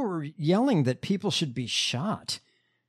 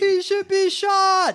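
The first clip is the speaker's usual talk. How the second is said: high-pitched, drawn-out crowd yell; imitated heckler's yell; 14 semitones higher, 4 dB louder, slower than usual. Co-host echoing the rally heckler, in a high mock yell. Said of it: "someone yelling".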